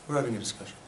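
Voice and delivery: low, soft conversational demo; quiet discussion voice. Shown as a demonstration. Teacher demonstrates having a discussion for the class, quiet and low. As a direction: speak softly, as if chatting quietly. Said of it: "suppose I'm talking like this".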